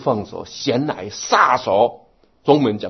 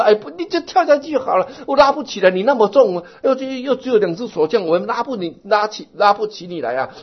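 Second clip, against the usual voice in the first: higher, louder calling down to the man; exasperated bystander; 11 semitones higher, 6 dB louder than usual. Retold or imitated. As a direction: shout down to him that you can't pull him up. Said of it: "他说".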